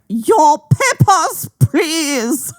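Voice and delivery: high, slow, hammed-up German officer accent; mock German accent. Friend teasing the storyteller, in a mock German accent. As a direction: bark it like a cartoon German soldier. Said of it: "I'm trying to do a Nazi accent".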